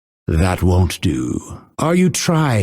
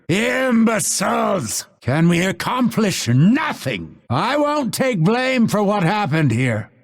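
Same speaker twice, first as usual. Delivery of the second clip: high-pitched exasperated tirade; angry outburst; 10 semitones higher than usual. Acted. Fighter blaming team after defeat, angry outburst. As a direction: berate them with raised pitch.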